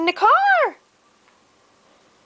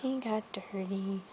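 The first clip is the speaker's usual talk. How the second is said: whisper; 8 semitones lower, 16 dB quieter than usual. Real, not acted. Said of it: "whispering to child during reading".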